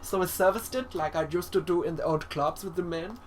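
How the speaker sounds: theatrical, campy German professor voice; camp German. Acted. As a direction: ham up a flamboyant German boffin.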